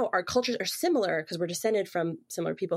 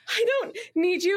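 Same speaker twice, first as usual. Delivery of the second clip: feigned indifference; 11 semitones higher than usual. Acted. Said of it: "Pretend that you don't want him".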